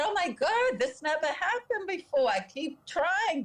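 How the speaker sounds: high, imitated distressed exclamation; retold crying panic. Dentist panicking over the dying patient, retold in a high distressed voice. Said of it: "he was crying".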